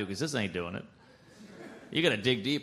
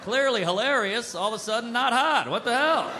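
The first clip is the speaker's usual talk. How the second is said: high-pitched, exasperated outburst building louder; exasperated protest; 8 semitones higher, 3 dB louder than usual. Acted. Comic protesting that the joke killed the mood, exasperated. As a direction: protest in rising disbelief at the injustice.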